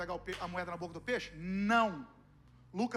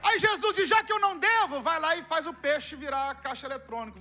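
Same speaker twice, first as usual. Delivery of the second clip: loud, high fervent preaching; preaching at full force; 5 semitones higher, 10 dB louder than usual. Acted. Preacher telling the congregation what Jesus said, loud and fervent. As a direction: keep the fire up.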